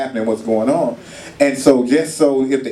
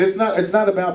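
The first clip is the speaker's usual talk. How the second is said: ordinary delivery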